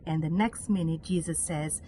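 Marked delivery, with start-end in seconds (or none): none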